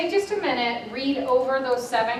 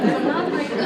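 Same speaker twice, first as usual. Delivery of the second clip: louder, higher, exasperated retort; "That's not the right word" pushed up and louder in mock annoyance; irritated; 5 semitones higher, 6 dB louder than usual. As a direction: snap back a bit fed up, half laughing. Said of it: "like, irritating me that he was saying that".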